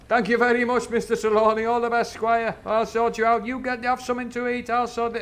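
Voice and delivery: high, loud oily servant voice; butter up the squire; fawning Silver. Retold or imitated. Silver flattering Squire Trelawney, high and loud. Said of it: "he's like that".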